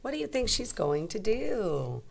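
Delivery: normal talking voice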